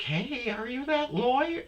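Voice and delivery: high chirpy woman imitation; curious gym lady. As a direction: pitch it up like a chirpy stranger. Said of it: "one lady was like".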